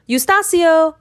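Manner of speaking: a high, loud call across the garden; eager call, high and loud. A narrator's reading. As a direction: call the boy's name from a distance.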